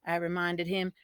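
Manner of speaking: usual speaking voice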